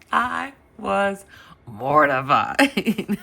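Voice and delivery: high voice breaking into laughs; mortified laughter. Real, not acted. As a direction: crack up over your own embarrassment. Cracking up at own embarrassment.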